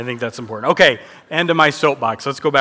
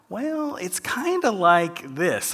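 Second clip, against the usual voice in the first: slow, hesitant, quieter hedge; unsure hedge; 3 semitones higher, 5 dB quieter, slower than usual. Retold or imitated. Imitating someone asked what a foreign word means, hesitant. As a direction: shrug it off as hard to explain.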